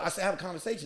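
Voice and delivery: ordinary delivery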